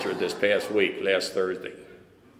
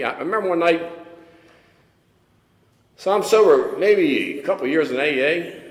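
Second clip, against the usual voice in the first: ordinary talk, nothing marked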